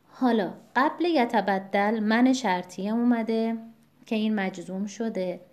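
ordinary talk

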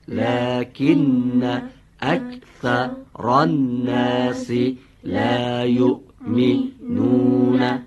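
slow chanted Arabic verse; Quran recitation. Shown as a demonstration. Teacher models recitation.

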